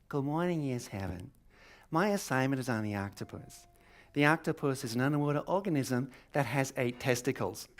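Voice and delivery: stilted schoolgirl presentation voice; imitated earnest little student; little girl presenting. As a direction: recite it like a young pupil at the front. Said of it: "this little girl".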